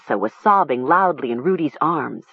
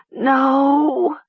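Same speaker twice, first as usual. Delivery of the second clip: long, high wail; drawn-out high cry of anguish; howling cry; 9 semitones higher, 3 dB louder than usual. A narrator's reading. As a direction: howl it like a wounded animal. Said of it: "sounded more like an animal howl".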